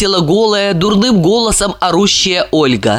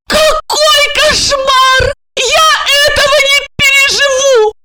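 very high, horrified wail; screaming; 3 dB louder than usual. A narrator's reading. Olga hanging on Georgy's neck, screaming. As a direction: wail it out in shrieking panic. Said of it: "и закричала".

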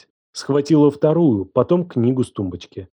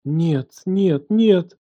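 higher, rising string of refusals; horrified denial; 6 semitones higher, much slower than usual. A narrator's reading. Елена backs away from him, mildly panicked. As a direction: deny it in mounting panic. Horror dawning, repeated denial.

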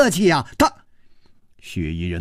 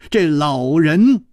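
plain reading voice

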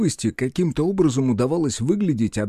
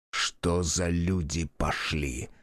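low, slow, soft grumbling; muttered grumble; 6 semitones lower, 7 dB quieter, much slower than usual. Acted. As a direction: mutter it under your breath. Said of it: "пробормотал он".

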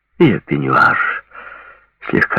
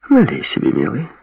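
drawling seductress; 8 semitones higher than usual. A narrator's reading. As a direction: coo it to him. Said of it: "протянула Лори".

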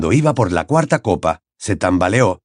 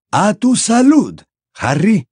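high, drawn-out toast; ceremonious, slow, high character voice; Dumbledore, making a toast; 11 semitones higher, slower than usual. A narrator's reading. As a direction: raise the glass to Harry, slowly.

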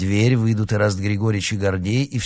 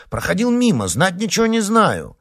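exclaiming; 13 semitones higher, 5 dB louder than usual. A narrator's reading. Panicked excuses.

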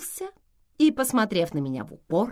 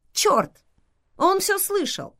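startled; 12 semitones higher than usual. A narrator's reading. Narrator's startled thought.

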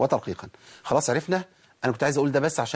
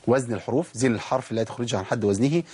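ordinary talk

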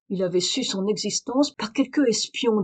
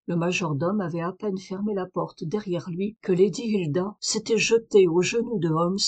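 plain reading voice

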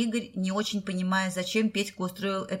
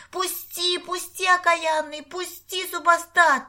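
much higher, whining fool's squeal; придурочный визг; 10 semitones higher, 5 dB louder, slower than usual. A narrator's reading. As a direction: play the idiot, wailing to be released. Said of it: "завизжал Петька совершенно придурочным голосом". (дурашливо визжа, высоким голосом)

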